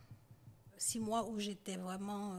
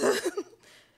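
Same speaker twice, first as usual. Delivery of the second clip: nervous laugh; 12 semitones higher, 13 dB louder than usual. Real, not acted. Nervous laugh in retold dialogue.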